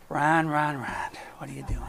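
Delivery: a hushed, breathy call from above, fading to a whisper; God calling softly. Pretend. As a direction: call his name gently, then whisper the question. God gently calling him, imagined.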